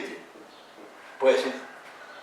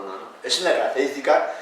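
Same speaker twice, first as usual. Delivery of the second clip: usual speaking voice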